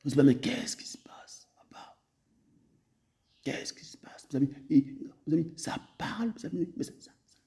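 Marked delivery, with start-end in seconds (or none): 0.2-4.3 whispered, puzzled aside; hushed wondering question. Retold or imitated. Preacher voicing a puzzled believer, whispered. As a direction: breathe the question like a confused believer.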